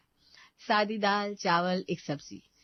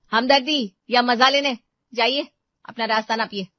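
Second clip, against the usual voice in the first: much louder, cutting retort; wife ordering the neighbour to leave, loudly angry; angry dismissal; 5 semitones higher, 10 dB louder than usual. Acted. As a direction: throw her out with scorn. Seema throws neighbour out.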